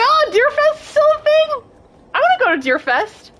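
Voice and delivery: excited whine. Retold or imitated. Excited childlike quote of herself.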